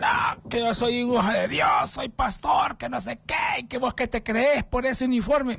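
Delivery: high shouted parody of the pastor; mock pastor shout. Pastor shouting at the policeman over his uniform. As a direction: yell it like a pompous preacher. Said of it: "y ese man".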